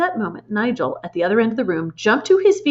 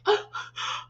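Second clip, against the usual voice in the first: strangled gasp; 6 dB quieter than usual. Retold or imitated. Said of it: "She's like".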